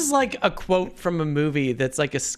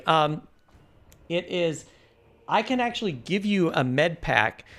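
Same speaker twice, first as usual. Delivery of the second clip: usual speaking voice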